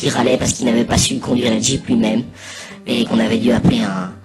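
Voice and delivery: ordinary delivery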